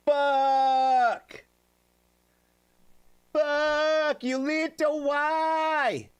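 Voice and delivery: long high yell, pleading at the end; anguished shout. Real, not acted. Streamer yells at Yulita for the sad song request. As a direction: cry out to the sky in mock despair.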